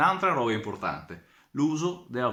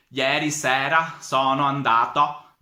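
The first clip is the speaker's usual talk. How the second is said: slow, louder Calabrian Italian; Calabrian accent; 2 semitones higher, 4 dB louder, slower than usual. Retold or imitated. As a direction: drawl it out like a Calabrian. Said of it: "specialmente dal calabrese".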